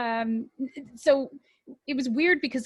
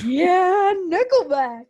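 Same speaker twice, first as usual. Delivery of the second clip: loud, high whoop of approval; excited cheer; 7 semitones higher, 10 dB louder, much slower than usual. Real, not acted. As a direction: cheer the band's name out loud. Cheering on mention of band.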